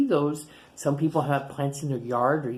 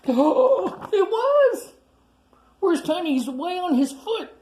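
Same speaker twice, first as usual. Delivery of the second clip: much higher and louder gasping discovery; excited surprise; 14 semitones higher, 5 dB louder than usual. Acted. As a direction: gasp and shout in delight. Discovering the tree is a foot.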